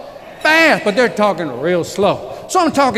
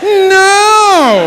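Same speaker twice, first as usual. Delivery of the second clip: loud, high, drawn-out "No"; mock flat refusal; 10 semitones higher, 7 dB louder than usual. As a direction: bark a mock-stubborn refusal. Flatly refusing the chocolate kid.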